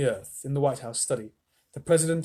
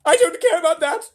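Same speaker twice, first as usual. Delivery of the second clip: high, loud screech, slowed; screaming grandma; 10 dB louder than usual. A narrator's reading. Grandma Josephine screams at Mr Wonka in terror, high shriek. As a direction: shriek it like a terrified old woman. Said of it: "shouted Grandma Josephine".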